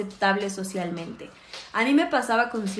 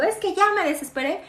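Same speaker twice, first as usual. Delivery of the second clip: higher, fed-up burst of a mother at her limit; exasperated outburst; 9 semitones higher, 3 dB louder than usual. Retold or imitated. Mother admits to her daughter she lost it, exasperated. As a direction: let the exasperation spill out, pitched up. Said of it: "yo le digo".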